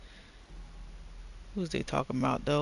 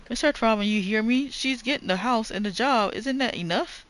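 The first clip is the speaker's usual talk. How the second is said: slightly higher, pressing voice; lightly voiced pleading character; 8 semitones higher, 3 dB louder than usual. Character pressing Mister Trautman, a lightly raised pleading voice. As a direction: plead your case with mild urgency.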